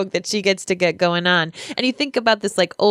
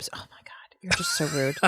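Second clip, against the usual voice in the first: hushed, playfully scandalized whisper; voiceless mock outrage; mock-offended whisper; 4 semitones lower, 11 dB quieter than usual. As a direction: whisper it as if appalled by the co-host. Mock offence at co-host's dismissal.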